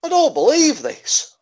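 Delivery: high, slow stunned voice; shocked disbelief. Retold or imitated. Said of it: "Max is just there just going".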